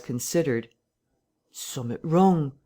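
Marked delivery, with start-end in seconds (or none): 1.5-2.5 slow, drawn-out rustic voice; a touch of West Country, slowly; slow rural English innkeeper; slow country Hall. A narrator's reading. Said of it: "said Hall".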